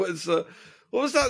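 usual speaking voice

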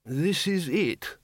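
high, very slow elder; drawn-out mild agreement; agreeable old wizard. Acted. Undead wizard agreeing with sergeant, mild.

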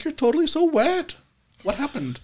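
very high, ditzy socialite voice; high, affected woman's voice; nicely dressed woman. Acted. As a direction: put on a high-pitched valley-girl lady. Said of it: "very nicely dressed woman".

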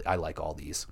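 hushed aside; whispering. Real, not acted. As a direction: whisper it like a secret. Whispered aside about disliked map.

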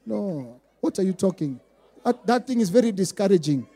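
normal talking voice

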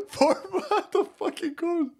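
high words broken by laughter; cracking up. Real, not acted. Host laughing about Four Flats, cracking up. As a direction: laugh helplessly through the words.

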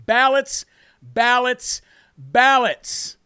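each "Ballots" punched out on its own with a pause after; emphatic repetition. Real, not acted. Host drumming the key word into viewers, emphatic repetition. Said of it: "Why am I emphasizing that word".

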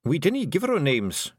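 high Scottish little man, grumbling; Feagle muttering. A narrator's reading. The Helmet Twiddler grumbling to Tiffany, high Scottish mutter. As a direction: mutter it like a stubborn wee clansman. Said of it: "he muttered".

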